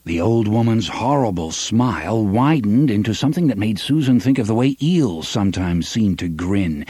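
usual speaking voice

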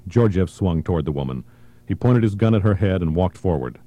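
ordinary delivery, nothing marked

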